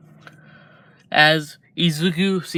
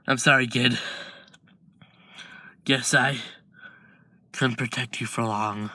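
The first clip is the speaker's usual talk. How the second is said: faint dying words; 2 semitones lower, 5 dB quieter than usual. Acted. Dying brother's last words.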